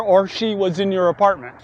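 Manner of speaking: ordinary delivery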